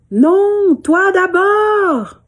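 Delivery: high, slow, loud stubborn call; defiant child. Acted. Girl calling back at the echo, insisting. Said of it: "elle dit".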